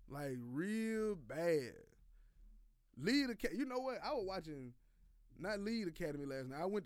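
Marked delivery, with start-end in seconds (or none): 0.1-1.7 drawn out. Real, not acted. Stretching out his disdain.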